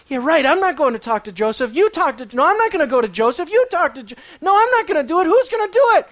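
panicky refusal. Acted.

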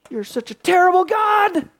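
high-pitched, acted complaint; mock accusation. Believer blaming God in prayer, mock accusing cry. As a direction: throw the blame upward in a high voice.

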